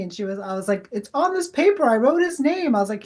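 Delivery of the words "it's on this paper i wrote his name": high, excited retold insistence; astonished insistence. Medium showing the client she wrote his name, astonished. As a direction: point to the proof, voice pitched way up.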